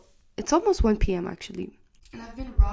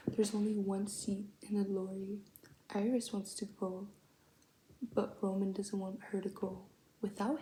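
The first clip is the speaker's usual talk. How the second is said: whispering; 10 dB quieter than usual. Real, not acted. Whispered recap.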